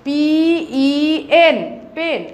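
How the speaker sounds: পি, ই, এন spelled slowly with gaps, then পেন; spelling aloud for children to repeat; letter by letter. Shown as a demonstration. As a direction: spell পি ই এন with a pause after each letter, then say পেন whole. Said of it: "বলো".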